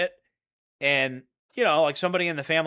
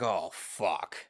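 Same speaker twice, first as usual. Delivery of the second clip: low, soft dismayed curse; muttered oh fuck; 8 semitones lower, 7 dB quieter than usual. Retold or imitated. Spotting the Marshal again, a quiet sinking curse. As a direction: sink into a quiet oh no. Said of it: "I was like".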